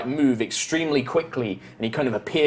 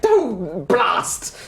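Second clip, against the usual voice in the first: flustered elf; 5 semitones higher, 3 dB louder than usual. Acted.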